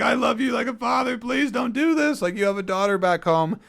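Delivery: high, loud mock-crying plea; Ben Affleck. Man pleading with his father figure to stay, imitated tearful yelling. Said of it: "like, screams, cries".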